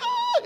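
put-on very high squealing cry of a child; kid's squeal. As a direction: squeal like a kid on a scooter. Little kids on scooters squealing.

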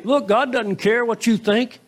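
mock a mean "Look", ending on a rise; mock mean retort. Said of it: "being mean here. He's not saying".

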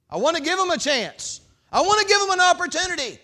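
loud, high preaching voice; full-force pulpit preaching. Acted. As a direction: preach it hard to the congregation. God's plea, preached loudly.

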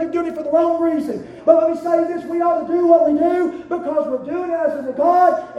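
loud, driving sermon cadence; full-force preaching. Acted. Pastor exhorting the church, full force. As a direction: rear back and preach it.

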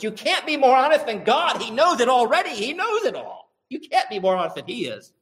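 high-pitched, loud, emphatic preaching; impassioned insistence. Real, not acted. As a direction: drive the point home with rising fervour. Preacher driving home his point.

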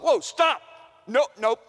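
loud, high refusal; urgent halt. Acted. Paul stops the poor givers hauling gifts in, loudly refusing. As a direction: cut them off with a loud protest.

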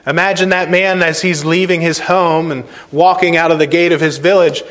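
ordinary talk, nothing marked

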